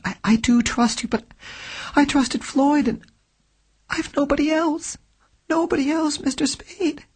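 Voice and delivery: put-on a frightened young woman, quickening toward the end; desperate young woman pleading. A narrator's reading. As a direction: beg him to trust her, voice rising. Client begs detective for trust.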